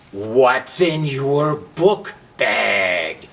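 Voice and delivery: very slow, drawn-out questioning; suspicious Match. Acted. Match grilling Book about the bag, slow and suspicious. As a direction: lean in and interrogate.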